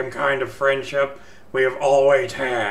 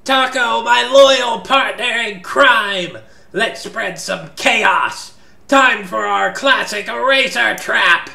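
high, loud and gleeful; rally your partner for mischief; excited; 8 semitones higher, 5 dB louder than usual. Acted. Danny hyping up a prank.

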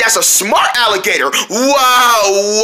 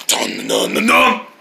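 dramatic dun dun; slower than usual. Acted. Dramatic sting, very low.

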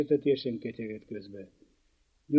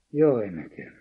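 usual speaking voice

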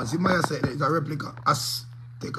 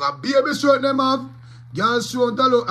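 high, loud mocking voice of the man; 9 semitones higher, 7 dB louder than usual. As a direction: ham up the man's words, pitched up. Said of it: "The man is saying".

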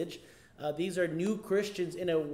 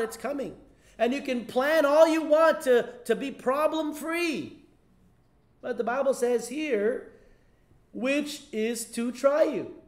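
higher and louder, urging the congregation; full-force preaching; 7 semitones higher, 5 dB louder than usual. Acted. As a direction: preach it hard from the pulpit, climbing.

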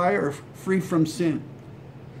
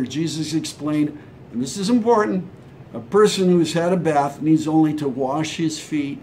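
usual speaking voice

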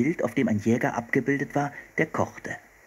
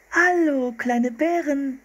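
put-on high, slow, delighted girl's call; delighted cry; 4 dB louder, slower than usual. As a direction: squeal with delight as Anne. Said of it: "rief Anne".

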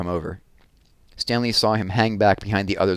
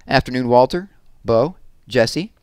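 greeting; 4 semitones higher, 5 dB louder than usual. A narrator's reading. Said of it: "he greeted them".

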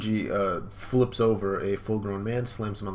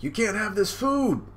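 put-on much higher, louder little-boy voice; young Sanji; 12 semitones higher, 5 dB louder than usual. Young Sanji tells the chained dog off in a high boy voice. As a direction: warn the dog off like a small boy. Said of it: "And then he's like".

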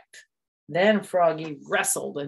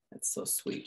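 whispering; 11 dB quieter than usual. Real, not acted. Whispered aside.

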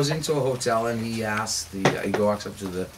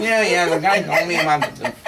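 raised, impatient brush-off; 6 semitones higher, 6 dB louder than usual. Acted. Guest brushing off the maitre d's soup pitch, impatient. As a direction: cut them off, higher and louder.

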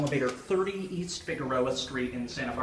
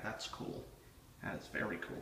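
whispering; 3 semitones lower, 8 dB quieter than usual. Real, not acted. Quietly admiring inside a bank.

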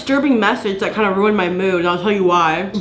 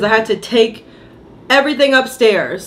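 ordinary delivery

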